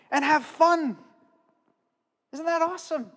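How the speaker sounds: high-pitched enthusiasm, building; retold excited wonder. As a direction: marvel to children, pitch climbing as you go. Recounts enthusing to children about creation.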